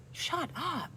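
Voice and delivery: a high, teasing, amused "Shut up"; playful high shut up. Shown as a demonstration. Vlogger shows the friendly way: playful high shut up. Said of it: "I'm saying it like".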